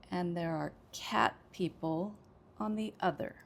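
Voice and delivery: "cat", "people" and "other" stressed. Shown as a demonstration. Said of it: "I would say cat, people, and other".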